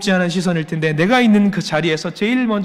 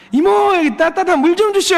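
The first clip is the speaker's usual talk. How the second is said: much higher, louder Jeolla call to the waitress; imitating Jeolla dialect; 14 semitones higher, 5 dB louder than usual. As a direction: shout across the restaurant in Jeolla dialect. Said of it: "전라도에서 배웠어요". (전라도 사투리로 크게 부르는 흉내)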